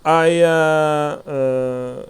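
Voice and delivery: halting, drawn-out hesitation; stuttering. Real, not acted. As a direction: stall on drawn-out filler sounds. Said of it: "I'm stuttering".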